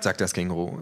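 ordinary delivery

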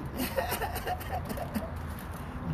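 high, soft wheezing laugh; long breathy cackle under the breath; wheezy laugh. Real, not acted. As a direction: laugh almost silently, mostly on breath. Laughing at own mocking remark.

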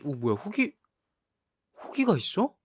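suddenly amazed, voice jumps up; surprised. Real, not acted. Surprised reviews exist. (깜짝 놀란 목소리)

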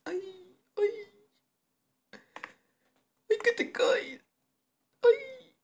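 shrill high alarm cry; panicked shriek. Real, not acted. Gamer sees character fall, shrieking in panic. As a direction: squeal as the character tumbles.

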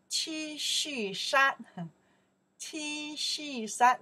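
T恤衫 drawn out slowly, syllable by syllable; slow T恤衫 down for learners; teacher stretching T恤衫 so students can follow; slow model word. Shown as a demonstration. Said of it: "Just follow the sounds".

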